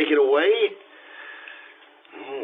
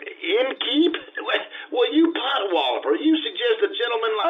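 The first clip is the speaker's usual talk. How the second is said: indignant protest; 11 semitones higher than usual. Acted. Giacomo offended outburst.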